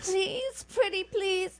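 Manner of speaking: high wheedling plea; pleading. Acted. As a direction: coax him like a spoiled child. Begging for coat.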